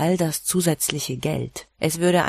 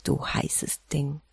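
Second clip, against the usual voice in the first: whispered, slow and softer; intimate whisper; 7 dB quieter, slower than usual. A narrator's reading. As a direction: breathe it into her ear slowly. Husband's flirt in close embrace.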